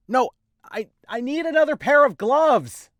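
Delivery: high, slow pleading cry; high distressed voice. Acted. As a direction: plead loudly and slowly.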